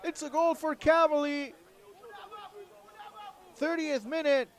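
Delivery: high, loud, thrilled play-by-play; excited goal call. Real, not acted.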